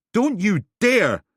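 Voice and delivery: loud, high, slow and fierce; furious warning. Acted. Helen warning Steele, furious and slow. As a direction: spit every word as a threat.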